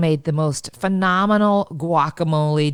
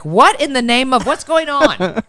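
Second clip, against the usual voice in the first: baffled listener; 6 semitones higher, 6 dB louder than usual. Retold or imitated. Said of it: "they're like".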